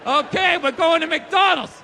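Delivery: a hammed-up brainwashed announcement; hypnotized obedience. The brainwashed self heading for McDonald's, loud and robotic. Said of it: "like the Manchurian Candidate".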